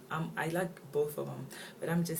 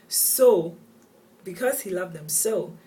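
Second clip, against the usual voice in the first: "So" punched much louder and higher; loud, raised emphasis on "So"; emphatic SO; 4 semitones higher, 12 dB louder, slower than usual. Real, not acted. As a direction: hit "so" hard, pitched up, to underline the reason. Stresses the paradoxical reason.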